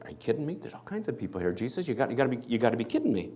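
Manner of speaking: quiet, exasperated disbelief; incredulous Peter. Acted. Peter to Jesus in the pressing crowd, quietly incredulous. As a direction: scoff at the idea, hushed. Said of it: "Peter's like".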